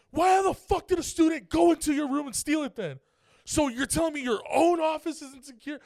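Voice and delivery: enraged father shouting back; angry yell. Retold or imitated. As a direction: yell it at the office worker. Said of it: "I don't mean to yell".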